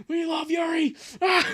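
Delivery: high shouted cheer; excited shout. Acted. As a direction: yell it like a crazed fan. Fan outburst dubbing comic.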